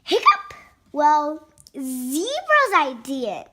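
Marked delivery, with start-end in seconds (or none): 0.0-0.5 hiccup sound. Acted. Elephant has the hiccups.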